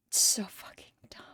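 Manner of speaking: hushed, voiceless aside; whispered aside. Real, not acted. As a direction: whisper it to yourself. Muttered aside to self.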